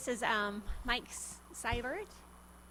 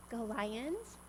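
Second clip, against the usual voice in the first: usual speaking voice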